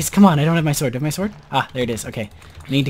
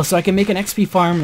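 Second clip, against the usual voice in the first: ordinary talk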